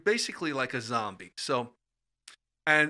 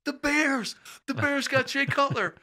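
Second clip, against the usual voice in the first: very high, exultant fan cry; retold excitement; 12 semitones higher, 3 dB louder than usual. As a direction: re-enact a thrilled fan breaking the news. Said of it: "I got so excited".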